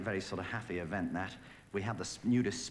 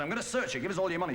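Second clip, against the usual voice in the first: higher, louder mock threat; hammed-up robber demanding money; mugger voice; 9 semitones higher, 3 dB louder, faster than usual. Comedian voicing the mugger stopping his wife, hammed-up threat.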